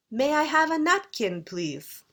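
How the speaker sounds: British tune, high on have, falling on please; British intonation. Shown as a demonstration. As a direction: ask it the British way, stepping down at the end. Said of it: "If you're British, you might say".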